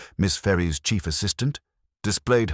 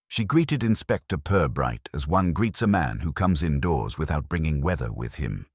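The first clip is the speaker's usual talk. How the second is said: usual speaking voice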